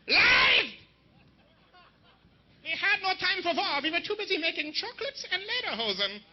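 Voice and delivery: high, indignant mock German denial; hammed-up outraged German woman; German accent. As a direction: shout the first word, then protest in a German accent. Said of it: "her mom is German".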